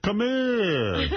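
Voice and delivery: high, laughing imitation of a Jersey senator; mock senator. Host mimics the senator's call while laughing, high mock Jersey voice. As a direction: ham up the senator beckoning.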